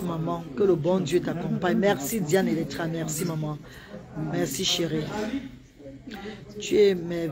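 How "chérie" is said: ordinary talk, nothing marked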